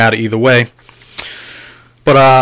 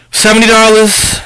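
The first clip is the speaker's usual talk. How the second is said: higher, louder exclamation over the price; excited exclaim; 7 semitones higher, 3 dB louder than usual. Real, not acted. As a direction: crow about the bargain. Gloating over the bargain price.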